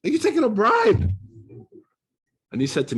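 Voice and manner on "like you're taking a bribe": high, laughing disbelief, getting louder; half-laughing, can't believe he's taking a bribe; shocked he's taking a bribe at an Islamic institution, high and laughing; incredulous outburst. Retold or imitated. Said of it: "I was like".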